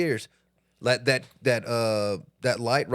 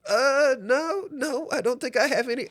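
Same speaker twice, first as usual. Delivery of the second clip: high, unsure little "uh, no"; hesitant polite refusal; 10 semitones higher than usual. Retold or imitated. Friend politely turning down a stranger, mock hesitant. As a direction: politely dodge the question, voice lifted.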